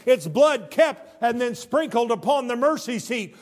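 loud, high, driving pulpit voice; preacher thundering scripture at his flock, loud and high; full-force preaching. Acted. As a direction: preach it hard to the congregation.

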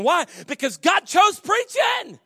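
slow, loud preacher's shout; shouted sermon line. Acted. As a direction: hammer it home slowly, full voice. Preaching at full force.

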